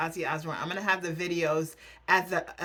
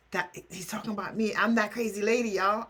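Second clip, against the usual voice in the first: usual speaking voice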